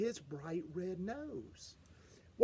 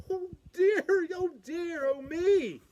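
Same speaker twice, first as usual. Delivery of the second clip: mock fright; 10 semitones higher, 6 dB louder than usual. Storyteller mock fright about monster.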